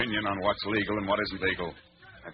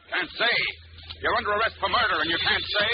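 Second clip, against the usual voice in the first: higher, louder outraged question; angry disbelief; 9 semitones higher, 4 dB louder than usual. Acted. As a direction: throw his words back at him. Commissioner angry at suspect.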